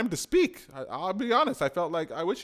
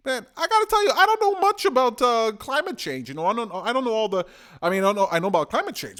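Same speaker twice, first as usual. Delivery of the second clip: excited outburst; 5 semitones higher, 6 dB louder, faster than usual. Real, not acted. Excited rant.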